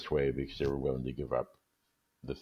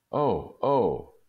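excited cry; 7 semitones higher, 6 dB louder than usual. Acted. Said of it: "she jumped up and cried".

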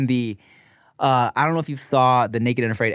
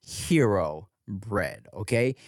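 ad read; 6 dB quieter than usual. Real, not acted. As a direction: sell it with measured, stretched enthusiasm. Reads a sponsor ad.

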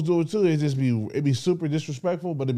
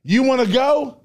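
put-on belligerent drunk; 7 semitones higher, 4 dB louder than usual.

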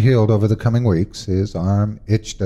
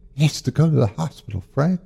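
higher, quick and pressing plea; urgent mother; 7 semitones higher than usual. Acted. Mother urging her husband, quick and pressing. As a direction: hurry him to act.

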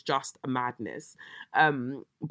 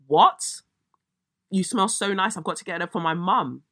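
mock disbelief; 4 semitones higher, 6 dB louder than usual. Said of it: "mocking the driver's line".

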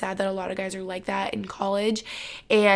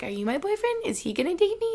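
much higher and softer, a wistful little voice; hopeful daydreaming; 9 semitones higher, 4 dB quieter than usual. Retold or imitated. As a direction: wonder aloud in a high, quiet voice. Imitating her hopeful past self.

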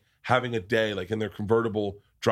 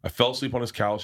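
ordinary talk

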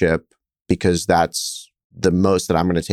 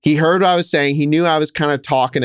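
higher-pitched, playfully confessing retelling; voice raised slightly; 6 semitones higher than usual. Retold or imitated. Guest admits playing to be overheard, voice raised a little. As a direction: lift your pitch as if meant to be overheard. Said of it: "You started raising your voice a little".

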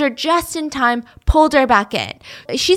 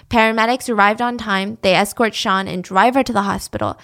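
ordinary delivery

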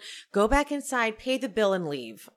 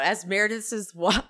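normal talking voice

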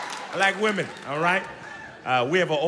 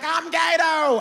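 mock tearful confession; 12 semitones higher, 4 dB louder, much slower than usual. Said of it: "He started crying".

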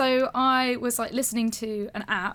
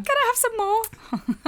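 pleading orphan; 11 semitones higher, slower than usual. Pretend. Playful Oliver Twist quote.

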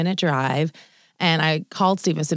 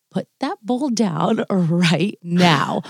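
mock stern order; much slower than usual. Ordering husband to drop the bowl.

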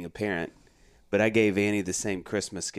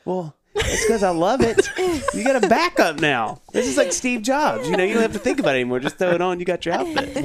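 hammed-up excuse; 9 semitones higher, 4 dB louder than usual. Defending repeat gift, hammed-up.

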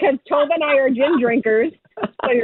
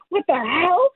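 normal talking voice

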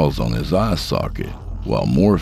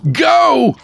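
high, loud urgent command; urgent shout; 6 dB louder than usual. Acted. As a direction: shout to make her run. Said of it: "shouted Frederick".

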